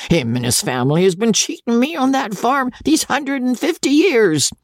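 high, rough squire grumbling; coarse old baronet. Acted. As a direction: grumble like a stingy old lord. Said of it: "said Sir Pitt".

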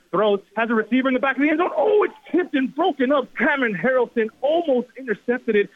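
excited. Real, not acted. Play-by-play call of a tipped pass.

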